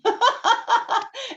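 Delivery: bright burst of laughter; laughing. Real, not acted. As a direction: laugh out loud. Laughs at own joke.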